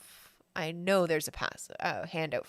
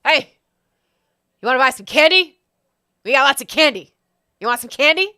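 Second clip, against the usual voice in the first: loud, raised-pitch candy hawker; yelling goblin; 6 semitones higher, 11 dB louder than usual. Acted. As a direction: yell out like a goblin vendor touting wares. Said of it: "yells to you".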